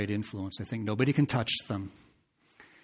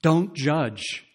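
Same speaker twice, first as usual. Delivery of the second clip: higher, louder sneering retort; mocking quote; 5 semitones higher, 6 dB louder than usual. Preacher mimicking the world scolding believers, loud and sneering. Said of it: "the refrain that we hear from the world".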